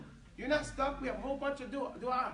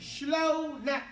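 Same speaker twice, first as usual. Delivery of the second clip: "Shlonak" stretched slowly, louder, for repeating; drawn-out "Shlonak"; 2 semitones higher, 5 dB louder than usual. Shown as a demonstration. Teacher modelling "Shlonak" for the class to repeat, drawn out. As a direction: draw out both syllables of "Shlonak".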